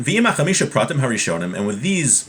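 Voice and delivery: normal talking voice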